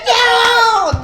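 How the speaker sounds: loud, high wailed no; hammed-up dismay; mock horrified cry. Nail technician seeing the result, mock horrified wail.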